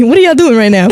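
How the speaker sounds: fast laughing question. Real, not acted. Teasing the clients about a group chat, laughing.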